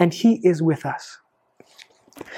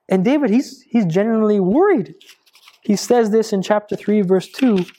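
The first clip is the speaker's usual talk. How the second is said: usual speaking voice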